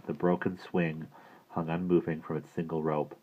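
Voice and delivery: usual speaking voice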